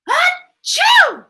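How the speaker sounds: a loud high sneeze to cut them off; fake sneeze. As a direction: sneeze on purpose to drown out the word. Mouse interrupts with sneeze.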